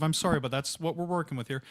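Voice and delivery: ordinary talk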